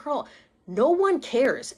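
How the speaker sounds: drawn-out, insistent retold reassurance; firm reassurance. Practitioner setting a crying friend straight, emphatic.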